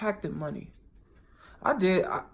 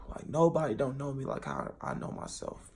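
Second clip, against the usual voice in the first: ordinary delivery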